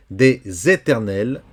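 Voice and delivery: "Des éternels" avec liaison /dez etɛʁnɛl/. Shown as a demonstration. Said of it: "comme si c'était un Z". (le S prononcé Z, lentement)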